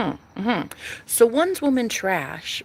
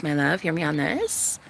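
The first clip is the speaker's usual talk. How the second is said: ordinary delivery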